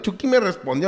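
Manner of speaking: ordinary delivery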